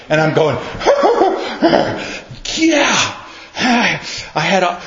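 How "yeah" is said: high strained squeal into a yelp; mock bursting yelp. Man told he will explode, mock bursting squeal. Acts being about to explode.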